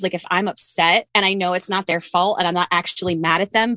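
ordinary talk, nothing marked

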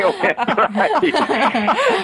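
drawn out very slowly through laughter; laughing. Real, not acted. Landing the punchline to the host, laughing and slow. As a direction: stretch the name out while cracking up.

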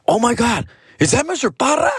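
high-pitched imitation of a startled girl; embarrassed shock. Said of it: "she's, like, all embarrassed".

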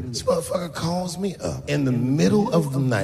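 normal talking voice

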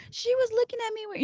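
high-pitched mock whine; put-on childish protest; whining child. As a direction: imitate a kid's petty complaint. Voices child's whiny excuse.

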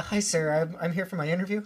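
polite, eager service-desk voice; customer service voice. Pretend. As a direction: sound like a helpful call-center agent. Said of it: "customer service voice".

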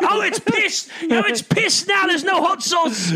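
very high, loud, slow Crocodile Hunter shout; excited Steve Irwin. Retold or imitated. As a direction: yell it like the beast is charging. Irwin voice describing angry animal.

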